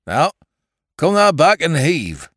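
loud country dialect command; rustic farmer order. Acted. Rustic rescuer instructing.

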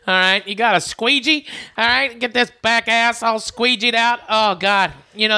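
ordinary talk, nothing marked

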